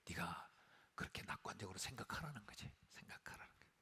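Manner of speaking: soft whisper of the point; a preacher whispering what the advice really means; sly whisper. Retold or imitated. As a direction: whisper it like a hidden truth.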